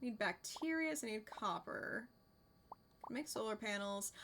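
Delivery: normal talking voice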